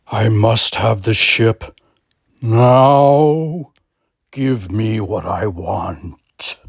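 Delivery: slow, then a loud now, then a whisper; slow demanding god. Acted. God demanding the ship, slow then whispered. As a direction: demand it slowly, end in a hiss.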